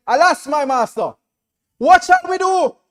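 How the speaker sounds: rising to a high, loud panicked cry; a servant crying out to his master in alarm; frightened servant. Acted. Servant to master at the sight of the army, loud panicked cry.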